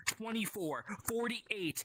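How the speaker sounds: high breathy counting; whispered counting. Acted. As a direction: whisper the numbers climbing. Counting escalating sides.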